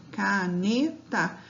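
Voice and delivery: "Caneta" drawn out slowly, syllable by syllable; slow, deliberate. Shown as a demonstration. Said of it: "eu falo ela pausadamente".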